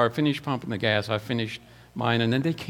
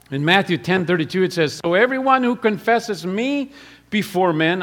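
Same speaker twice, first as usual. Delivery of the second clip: loud raised preacher's quote; preaching; 7 semitones higher, 6 dB louder than usual. Acted. Preacher proclaiming scripture to the church, loud and raised.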